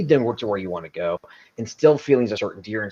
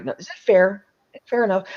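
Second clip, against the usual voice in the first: lightly voiced reasonable man, a touch higher; reasonable man tonality; 10 semitones higher than usual. Shown as a demonstration. Recruiter demoing a negotiation voice, lightly reasonable. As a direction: sound fair and easygoing. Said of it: "using the reasonable man tonality".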